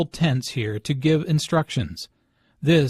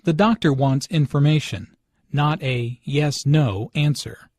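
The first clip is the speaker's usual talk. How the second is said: ordinary delivery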